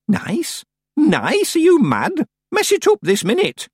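put-on high, loud, outraged old woman; demanding old witch. A narrator's reading. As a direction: bark orders like an offended old witch. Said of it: "Miss Treason demanded".

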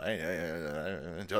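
low, soft hey repeated; quieting down. Real, not acted. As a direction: settle the room softly. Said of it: "calming himself down".

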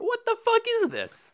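high, incredulous exclamation; high-pitched outrage. Real, not acted. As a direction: blurt it out high in comic disbelief. Mock outrage at friend.

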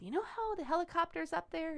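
put-on high, coaxing talk-to-kid voice; explaining to a child. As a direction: lead a little kid into a tall tale. Adult spinning Santa story for child.

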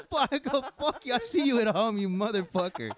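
high-pitched retold cursing; upset, fed up. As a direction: sign off in comic exasperation. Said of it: "when you get upset".

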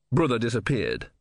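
ordinary delivery

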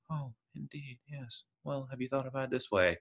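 mock a refined, unbothered psychiatrist; hushed, haughty and refined; refined dry aristocrat. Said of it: "plays that refined, kind of dry".

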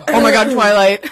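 loud mimicked fangirl outburst; mock excited cry. Imitates friend's repeated excited cry.

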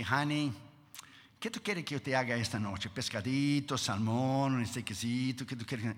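sweet wife voice. Retold or imitated.

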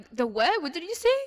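half-hearted imitation of a sneering guy; mocking customer. Customer mocking the cashier's accent, loud mocking question. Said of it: "Like, trying to mock me".

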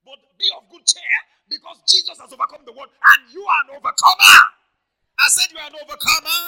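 high, shouted rallying declaration; full-force preaching. Real, not acted. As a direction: proclaim victory to the congregation at full voice.

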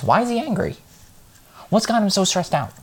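squeaky high mocking voice, far up his range; a shrill parody of a complaining wife. Mimicking a partner's confused questions in a high voice.

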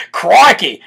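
hammed-up Aussie exclamation; Australian accent. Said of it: "you're creeping me out with that accent".

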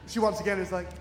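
normal talking voice

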